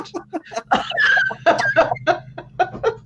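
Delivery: bright high burst of laughter; giggling at her own muddle. Real, not acted. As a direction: laugh at herself, high and free.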